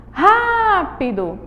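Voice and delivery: Rápido with "Rá" held long and high; stressed first syllable. Shown as a demonstration. Teacher asks pupils to notice the tonic syllable, stretched "Rá" in Rápido. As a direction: stretch the opening "Rá" of Rápido, then drop. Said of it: "Olha a sílaba tônica".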